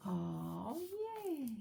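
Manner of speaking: plain reading voice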